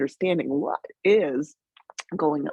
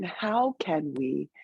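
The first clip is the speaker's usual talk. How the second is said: normal talking voice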